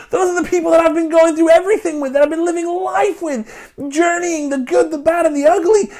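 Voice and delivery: high, loud, desperate pleading near tears; crying out to God. Retold or imitated. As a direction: beg God to spare the people, voice breaking. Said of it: "He cries out to God".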